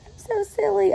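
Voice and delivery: high, cutesy "I'm so silly" voice; mock silly millennial. Vlogger mocking millennial videos: high cutesy silly voice. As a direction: play a goofy camera-mugging millennial, pitched high. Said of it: "those videos where they make goofy faces".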